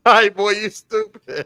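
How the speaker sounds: high-pitched, laughing exclamation. Real, not acted. Co-host cracking up at friend's podcast joke, laughing. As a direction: crack up while teasing your friend.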